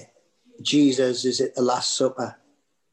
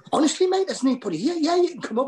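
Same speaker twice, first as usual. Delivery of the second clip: much higher, pleading innocence; high protesting; 13 semitones higher, 3 dB louder, faster than usual. Retold or imitated. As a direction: insist nobody's here, pitch up.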